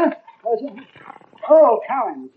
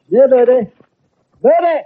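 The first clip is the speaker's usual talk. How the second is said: calling out; 5 semitones higher, 5 dB louder than usual. Acted. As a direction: call out high to the birds. Calling the birds in the garden.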